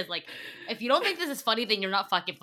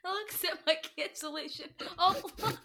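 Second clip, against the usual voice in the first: laughing imitation; 6 semitones higher, 5 dB quieter than usual. Repeating co-host's absurd line, laughing imitation. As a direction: repeat it helplessly amused, voice climbing.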